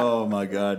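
ordinary talk

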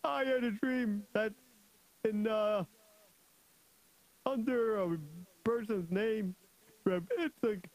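high-pitched mocking soldier, opening on a laugh; scoffing soldier. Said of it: "so the soldier said".

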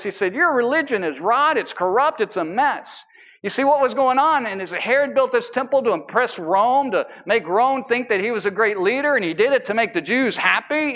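loud, driving sermon delivery, pushing every line; full-force preaching. Acted. Pastor preaching to his congregation, loud fervent preaching. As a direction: preach it hard to the back row.

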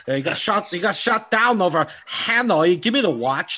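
a light Christopher Walken cadence; Walken impression. Quoting the watch speech from the movie in a Walken voice. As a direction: slip into Walken's halting delivery. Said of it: "your Walken impression's really good".

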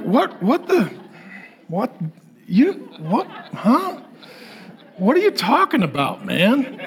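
half-laughing confusion at fancy jargon; baffled. Retold or imitated. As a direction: react like you can't follow a word. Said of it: "You're like".

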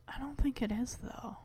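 unvoiced whisper, trailing away; whispered aside. Real, not acted. Muttered aside about the stereo.

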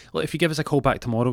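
high, fast receptionist patter; brisk put-on receptionist. Voices receptionist on phone.

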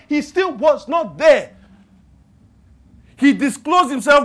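loud, punchy preacher cadence; preaching at full force. Acted. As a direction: hammer each not in the list.

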